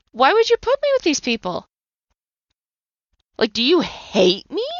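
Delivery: a desperate accusation flung upward; anguished cry at God. Retold or imitated. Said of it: "I yelled and I screamed".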